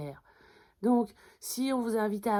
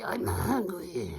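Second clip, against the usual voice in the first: slow growled-out declaration with heavy H; hammed-up anger, dragging the words; mock super angry; much slower than usual. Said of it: "si vous êtes super en colère".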